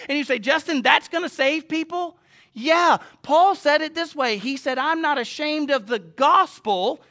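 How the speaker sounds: full-force preaching. Real, not acted. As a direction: drive the gospel home to the congregation.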